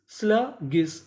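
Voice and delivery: Sluggish in two chunks, स्ला ... गिस; teacher's two-beat breakdown of sluggish; broken into parts. Shown as a demonstration. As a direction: pause inside sluggish before गिस. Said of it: "टुकड़ों में तोड़ तोड़ के पढ़ें".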